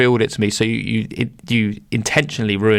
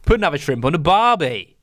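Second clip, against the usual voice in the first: a hammed-up Australian accent, pitched up; the tourist-ad Australian accent, played for laughs; mock Australian; 7 semitones higher than usual. Cohost joins the joke with a stock Australian accent. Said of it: "Come on, do an accent".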